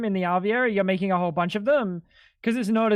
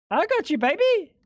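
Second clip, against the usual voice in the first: "I got you, baby" in a high put-on Texas-style twang; put-on accent; 10 semitones higher than usual. Putting on an accent for Nuka, high and teasing. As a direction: tease Nuka with a playful drawled accent. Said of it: "I can put on an accent for you".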